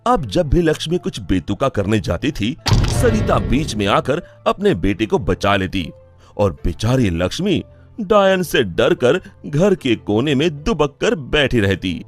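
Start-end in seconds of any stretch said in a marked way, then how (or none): none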